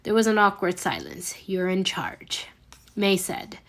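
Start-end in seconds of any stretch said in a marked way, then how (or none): 1.5-2.5 breathless, hushed whisper; a shocked whisper under the breath; stunned whisper. A narrator's reading. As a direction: barely get the words out, whispered. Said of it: "a bit speechless".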